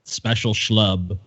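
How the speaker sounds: low, slow savoring echo; mocking echo. Real, not acted. Co-host repeating the phrase, slow low mock echo. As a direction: drag it out, low and amused.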